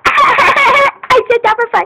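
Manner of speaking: giggly slow line, high and loud; laughing. Real, not acted. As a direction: laugh through it. Laughing after silly intro.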